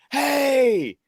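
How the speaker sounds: high, stretched-out mock holler; drawn-out, high-pitched fan greeting; imitated fan call-out. Imitating fan calling out in store.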